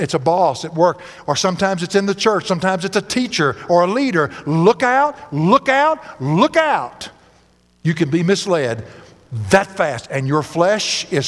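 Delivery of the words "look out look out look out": raised, rising calls of alarm; urgent warning. Acted. Preacher warns church of being misled, urgent warning. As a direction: sound the alarm, stretching each call.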